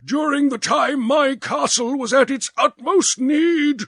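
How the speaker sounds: high, indignant demand; furious prince; angry old prince. A narrator's reading. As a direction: rage at the deserters. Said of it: "he was in a towering passion".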